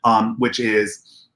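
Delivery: normal talking voice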